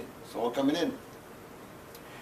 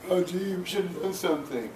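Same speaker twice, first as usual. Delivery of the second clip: higher-pitched, put-on naive regret; mock regret; 4 semitones higher than usual. The poet mocks people who finally understand police killings, in mock regret. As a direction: imitate people who caught on too late.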